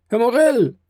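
high-pitched excited call. A narrator's reading. Someone on the stairs calling Morel, high shout. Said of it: "rief man auf der Treppe".

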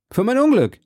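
high, loud lament; emphatic plaint. Acted. D'Artagnan asks Athos to console his misfortune, high and plaintive. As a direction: cry it out pitched high.

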